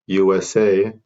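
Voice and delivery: ordinary talk, nothing marked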